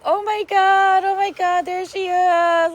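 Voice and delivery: high, slow, breathless delight; gushing excitement. Retold or imitated. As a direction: swoon at the sight of her. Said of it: "I was just like".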